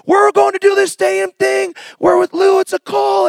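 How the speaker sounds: high, loud, eager pitch about the stadium; a friend excitedly pitching the stadium plan, shouting high and eager; excited friend calling. Retold or imitated. Friend on the phone urging a stadium ministry, high excited shout. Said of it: "he's calling me".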